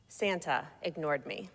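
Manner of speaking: usual speaking voice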